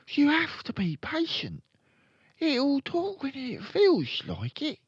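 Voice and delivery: put-on high-pitched little-boy voice; high boy character; young Fred. A narrator's reading.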